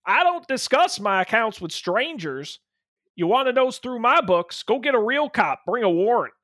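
a hint of a gruff lumberjack boss; gruff booming lumberjack. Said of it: "I'm gonna do a lumberjack".

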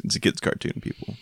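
hushed aside to listeners; whispered aside. Real, not acted. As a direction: whisper it reassuringly. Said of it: "aside to listeners".

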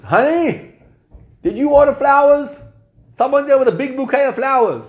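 high, loud call into the house. Retold or imitated. Father calls to his wife about the flowers at the door, loud and high. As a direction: call to the wife in the back room.

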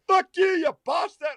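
high, loud shouted insults at a friend; high comic shouting; mock road rage yell. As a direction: yell across the traffic light for fun. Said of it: "he was still like".

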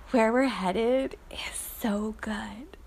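slow, hushed, blissful; peaceful whisper. Real, not acted. Waking in the dark, savoring the peace, slow whisper. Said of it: "feeling the joy and the peace".

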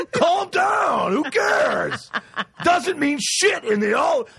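high, exasperated yelling; hammed-up angry rant; getting all mad. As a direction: shout down her worries, stretching the words. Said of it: "I get all mad".

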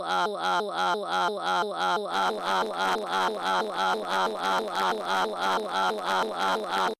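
stuck repeating stream glitch; broken looping sound of a dropped feed; glitched audio stutter. Real, not acted. Livestream cutting out mid-sentence, stuttering glitch.

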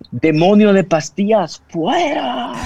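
hammed-up demon rebuke through laughter; mock exorcist.

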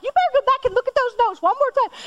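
high, fast, insistent nagging; insistent mom. Retold or imitated. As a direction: push her back to the books. Mother pushing daughter to study.

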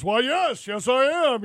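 lightly imitating Gene's big booming voice. Retelling a fan meeting, lightly imitating Gene's big voice. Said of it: "He gets that big old voice going".

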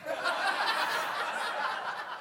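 high, silent wheezing laugh; breathless laugh. Real, not acted. Preacher laughing at his own fussy quote, silent wheeze. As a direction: crack up without a voice.

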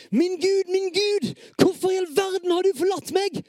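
high, loud desperate shout to God; anguished cry. Acted. Same psalm line, anguished second take.

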